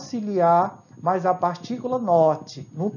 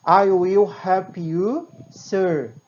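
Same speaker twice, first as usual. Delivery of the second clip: slow example; 4 dB louder, much slower than usual. Shown as a demonstration. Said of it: "utilizamos o eu para expressar".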